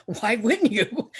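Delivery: ordinary talk, nothing marked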